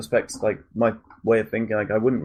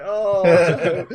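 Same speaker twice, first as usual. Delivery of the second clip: high, louder laughing oh no; laughing mock dismay; 8 semitones higher, 5 dB louder than usual. Retelling his reaction to the passenger, high and laughing. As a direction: laugh out the dread.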